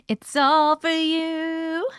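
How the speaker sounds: stretched slowly and sweetly; drawn-out. Acted. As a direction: draw each word out slowly.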